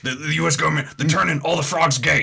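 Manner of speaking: loud ranting conspiracy host; Alex Jones. Retold or imitated. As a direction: paranoid radio rant. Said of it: "That's exactly what he sounds like".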